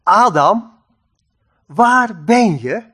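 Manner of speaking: measured, resonant call to someone hiding. Acted. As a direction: call out searchingly, stretching the question. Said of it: "Hij riep de mens". (roepend, zoekend naar iemand)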